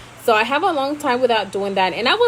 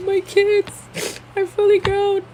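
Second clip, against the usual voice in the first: hammed-up weepy wail, higher and louder; mock crying; 11 semitones higher, 3 dB louder, slower than usual. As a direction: pretend teary wail, higher. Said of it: "I'm gonna be like".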